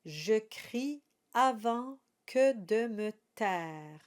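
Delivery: slow, careful recital of French verse; poem recitation. Shown as a demonstration. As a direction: read each line like a poem, unhurried. Recites song lyrics slowly.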